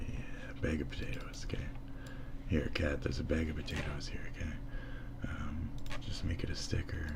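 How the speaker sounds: hushed whisper to the cat. Pretend. Streamer whispering to the cat about the potatoes. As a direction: whisper it like a secret.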